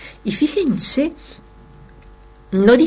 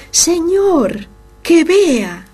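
pleading cry; 8 semitones higher, 3 dB louder, much slower than usual. Acted. Blind man begging to see.

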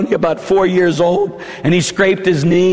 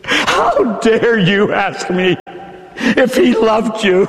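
slow, bitter reproach; indignant rebuke; 4 semitones higher, slower than usual. Acted. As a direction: scold through tears. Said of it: "you hear her cry out".

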